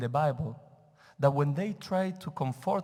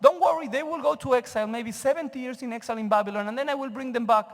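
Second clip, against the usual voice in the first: high, loud voice of a calm, reasoning God; rational God; 7 semitones higher, 4 dB louder than usual. Acted. The rabbi voicing God to his class, higher and louder. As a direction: talk as God, sure and rational, a notch up. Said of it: "God is being very rational here".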